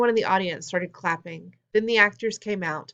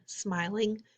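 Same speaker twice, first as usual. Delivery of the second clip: ordinary delivery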